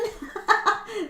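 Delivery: a quick high laugh; soft amused laugh. Real, not acted. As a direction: laugh it off lightly. Laughs at viewer's question.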